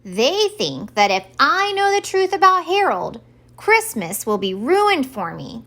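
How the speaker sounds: Santa crying out his suspicion, mildly hammed up; cried out. A narrator's reading. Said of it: "cried Santa".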